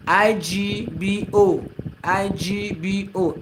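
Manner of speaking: slow letter-by-letter spelling. Shown as a demonstration. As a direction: spell each letter slowly and separately. Spelling the word for viewers.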